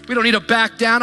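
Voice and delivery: ordinary talk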